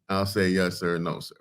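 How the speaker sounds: ordinary talk, nothing marked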